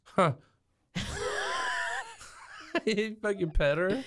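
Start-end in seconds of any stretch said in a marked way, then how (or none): 0.9-2.8 high squealing burst of laughter; a shocked squeal of a laugh; real shocked laugh; shrieking laugh. Real, not acted. Bursts out at the cheek touch.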